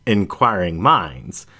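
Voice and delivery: ordinary delivery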